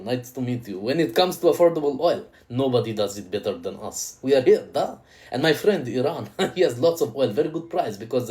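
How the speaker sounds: a light hint of a Russian accent, sly salesman; a Russian accent, smooth oil dealer; mock Russian oilman. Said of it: "And then Russia's gonna be like".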